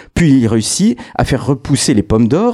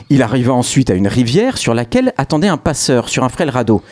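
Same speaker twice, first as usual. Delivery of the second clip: ordinary talk, nothing marked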